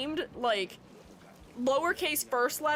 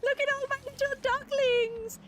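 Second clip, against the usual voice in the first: cooing; 10 semitones higher, slower than usual. Acted. Cooing at the ducklings.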